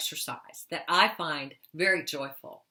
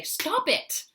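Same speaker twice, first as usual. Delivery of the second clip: sharply higher, demonstrated angry command; mad and angry; 11 semitones higher than usual. Shown as a demonstration. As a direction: show how to tell the bully off, pitch jumping up. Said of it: "get mad and angry and say".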